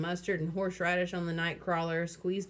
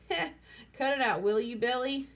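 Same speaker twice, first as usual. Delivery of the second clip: nervous chuckle; 6 semitones higher than usual. Acted. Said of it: "chuckling nervously".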